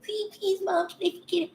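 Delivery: put-on pleading kid. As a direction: mimic a kid pestering mom in a high little voice. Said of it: "not come at me from a pleading voice".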